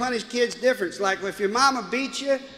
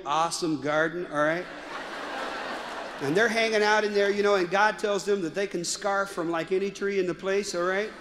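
put-on valley girl; 3 semitones lower than usual. Daughter retelling Genesis to her friend, valley girl voice. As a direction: lower valley girl storyteller. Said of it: "a valley girl".

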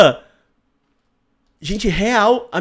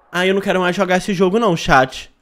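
ordinary talk

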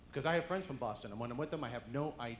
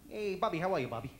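Brooklyn greeting; 5 semitones higher, 6 dB louder than usual. Retold or imitated. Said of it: "talking to somebody from Brooklyn".

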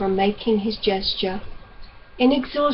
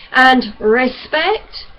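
savagely; 3 semitones higher, 7 dB louder, much slower than usual. Acted. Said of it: "Francis said the word savagely".

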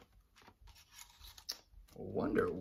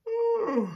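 sleepy groan; 6 dB louder than usual. Acted. Panda groans waking.

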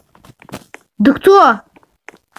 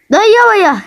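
exasperated; 7 semitones higher than usual. Real, not acted. Sudden exasperated outburst.

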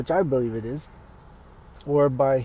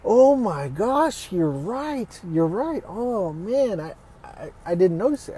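imitating friend's realization; 5 semitones higher, slower than usual. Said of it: "And she's like".

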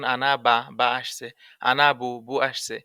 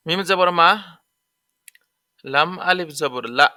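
drill recitation; 3 semitones higher, 6 dB louder than usual. Shown as a demonstration. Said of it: "spelling drill aloud".

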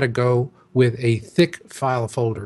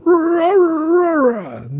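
high-pitched growly "rah rah" of a protest mob; cartoonish high roar, falling off at the end; mock crowd roar; 5 dB louder, much slower than usual. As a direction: imitate an angry mob's garbled yelling. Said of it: "raising their fists and going".

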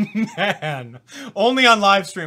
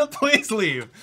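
higher and louder, laughing through the words; laughing please leave; 6 semitones higher, 5 dB louder than usual. Real, not acted. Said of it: "I straight up said, "Please leave".

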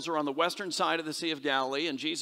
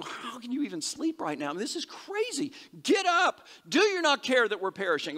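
high-pitched, exasperated disciples, acted out; irritated panic; 7 semitones higher than usual. Disciples waking sleeping Jesus in the storm, exasperated.

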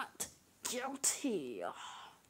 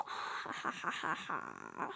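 much lower, slow villain laugh; evil laugh. Acted. Villain gloating.

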